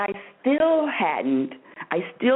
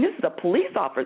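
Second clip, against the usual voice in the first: ordinary talk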